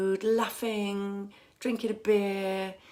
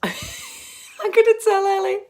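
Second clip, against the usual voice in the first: higher, slow words through real laughter; giggly and delighted; laughing; 12 semitones higher, 10 dB louder, slower than usual. Real, not acted. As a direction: laugh as you say it. Laughing at viewer's message.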